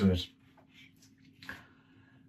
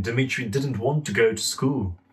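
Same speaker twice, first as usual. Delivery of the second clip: ordinary delivery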